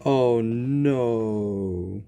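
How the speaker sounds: ordinary talk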